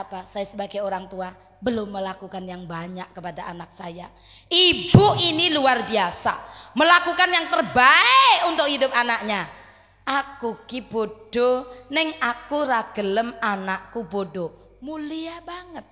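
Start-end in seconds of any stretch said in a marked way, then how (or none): 4.5-9.5 fervent preaching. Acted. Preacher praising the mother loudly. 10.0-14.8 very slow, deliberate humble words; lightly voiced humble mother, drawn out; quoting the mother. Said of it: "jawabannya mengeten".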